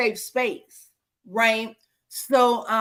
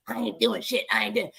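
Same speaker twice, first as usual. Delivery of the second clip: softer, blunt refusal imitated, mouth full of spit; cursing old man; 4 dB quieter than usual. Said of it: "cursing me out with a mouthful of spit".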